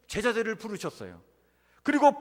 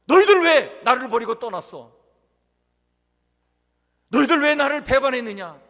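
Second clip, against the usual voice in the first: loud, high mock scolding; acted stern rebuke; 6 semitones higher, 10 dB louder than usual. Preacher voicing the rebuke Jesus never gave disciples, loudly reproachful. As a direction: hit the opening hard, like an accusing reproach. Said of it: "이렇게 책망하시고 질책하지 않으셨어요".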